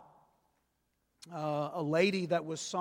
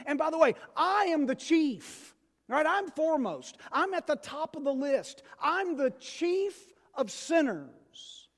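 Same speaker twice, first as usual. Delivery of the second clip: preaching at full force; 8 semitones higher than usual. Real, not acted.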